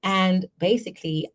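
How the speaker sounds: usual speaking voice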